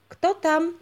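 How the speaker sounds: high, loud call through the gate; who's there, called out loud and high; call from behind the gate. A narrator's reading. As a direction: voice calling from inside the castle. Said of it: "znowu usłyszał".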